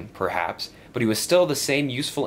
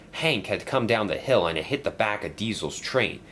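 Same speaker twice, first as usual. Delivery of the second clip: ordinary delivery, nothing marked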